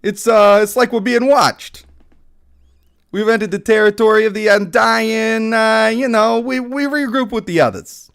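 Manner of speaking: high, loud Jersey bro voice; Jersey Shore guy. Acted. Jersey Shore elf tells the party they are watched, high and loud. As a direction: keep the hammed-up Jersey act. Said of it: "I wish I could do a Jersey Shore guy".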